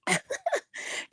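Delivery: soft high-pitched giggle; high breathy laugh. Real, not acted. Host laughs until her ribs ache, high soft giggle. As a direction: laugh that trails off quietly. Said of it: "I was cracking, um, cracking my ribs".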